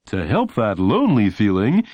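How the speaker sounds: bright, punchy TV ad pitch; announcer voice. A narrator's reading. Imagined announcer selling a doll, upbeat ad read. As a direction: sell it like a commercial announcer. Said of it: "the announcer would say".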